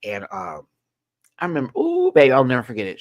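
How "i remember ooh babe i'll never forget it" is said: ordinary delivery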